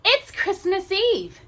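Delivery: put-on high, slow childlike complaint; little boy voice. Little boy complaining to the angel, high and slow. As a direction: drag it out like a sulky small boy.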